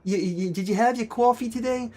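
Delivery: put-on New York accent, rounded aw vowels. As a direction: do a New Yorker with "wawking" and "cwawfee". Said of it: "New Yorkers do the same thing too".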